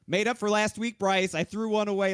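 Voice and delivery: proud, pumped-up race winner; fired-up victory excitement. Real, not acted. Race winner celebrates making up a loss.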